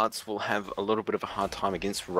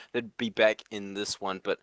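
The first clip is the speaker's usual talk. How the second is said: normal talking voice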